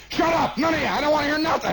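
high-pitched shouted order; bus driver shouting. Retold or imitated. Driver lining up passengers, high shout. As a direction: silence the crowd with a shout. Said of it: "He says".

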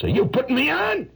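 high-pitched indignant question; suspicious challenge. Acted. Painter suspecting he is being mocked, high indignant challenge. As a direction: demand it in a high, affronted voice.

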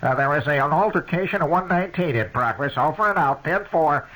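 mock dispatcher over the radio; police radio dispatch. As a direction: sound like a squawking police radio. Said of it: "the little thing says".

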